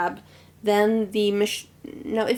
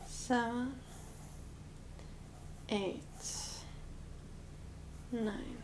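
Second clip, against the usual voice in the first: counting under breath; 3 semitones higher, 16 dB quieter, slower than usual. Real, not acted.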